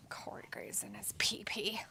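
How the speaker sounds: whispered tease; giggly whispered aside. Real, not acted. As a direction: murmur the joke under your breath. Teasing aside after the P P joke.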